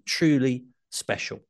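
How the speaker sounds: ordinary delivery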